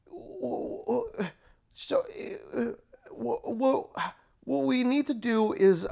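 high, halting pundit with stammers; mock radio pundit. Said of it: "or they say".

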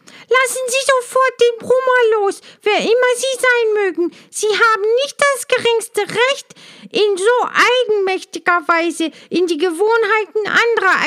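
high, loud little-bee cry; a small bee bravely yelling orders; loud call. A narrator's reading. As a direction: shout up at the dragonfly, outraged. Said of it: "rief sie laut".

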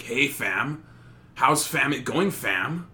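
lightly put-on Toronto slang; Toronto slang accent. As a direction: toss in fam like Toronto slang. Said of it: "They're like".

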